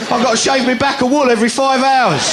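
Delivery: high, loud, wounded plea; comic sheep-kidney man pleading, high and loud; aggrieved sheep man. Acted. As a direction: beg them not to laugh, hammed up. [name]'s sheep-kidney bit.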